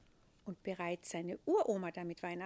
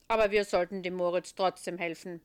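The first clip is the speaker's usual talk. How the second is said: put-on louder, changed voice of the old saint; a weighty heavenly boss musing; Petrus; 11 dB louder, faster than usual. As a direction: Petrus musing to the little angel.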